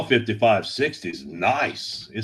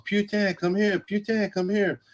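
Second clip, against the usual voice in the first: put-on high singsong little girl calling; child's voice calling the dog; kid calling the dog; 10 semitones higher than usual. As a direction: call the puppy like a small child, high and bright. Kid calling the dog around house.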